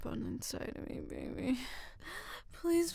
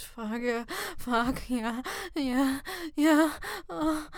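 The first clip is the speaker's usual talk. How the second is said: much louder, high climaxing cries; ecstatic cries; 4 semitones higher, 5 dB louder than usual. Acted. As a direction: let the voice break loose at the peak. Peak loud cries.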